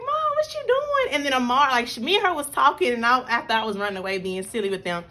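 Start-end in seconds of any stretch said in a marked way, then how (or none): 0.1-1.1 put-on very high, childlike question; high little girl voice. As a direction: squeak it like a small curious kid. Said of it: "And she was like".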